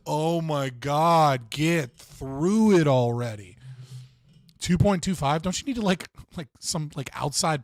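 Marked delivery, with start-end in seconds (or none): 0.1-3.6 slow, drawn-out exasperated groan; impatient viewers. Retold or imitated. Viewers fed up with every pause, drawn-out mock exasperation. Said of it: "they're gonna be like".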